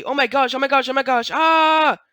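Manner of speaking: mock terror as the hole opens; oh my gosh building into a yell; panicked scream. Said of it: "and then the subtitle say".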